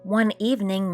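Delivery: plain reading voice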